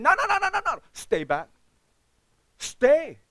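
high, loud string of no's; a loud, insistent plea to stay; emphatic pleading no. Retold or imitated. Retold pleading with members.

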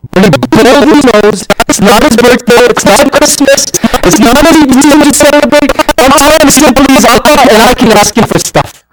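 rising preaching fervor. Real, not acted. Preacher building to the congregation, loud fervent preaching. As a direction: pitch climbs high and loud, building intensity.

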